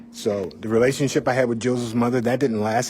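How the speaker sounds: ordinary delivery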